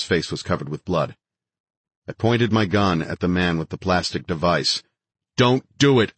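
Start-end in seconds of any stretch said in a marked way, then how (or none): 5.4-6.1 raised, higher warning yell. A narrator's reading. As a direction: yell a warning at an armed man. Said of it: "I yelled in Farsi".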